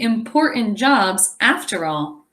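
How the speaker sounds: ordinary delivery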